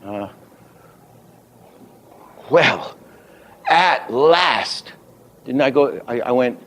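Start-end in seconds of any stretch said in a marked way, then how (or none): 2.5-4.9 "at" and "last" each punched high; two head accents. Shown as a demonstration. Teacher demonstrating head accents, stress on "at" and "last". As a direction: separate "at" from "last" and hit both. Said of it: "two head accents".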